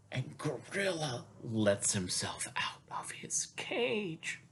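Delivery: slow secretive whisper; hushed storytelling whisper. A narrator's reading. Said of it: "whispered sneaking narration".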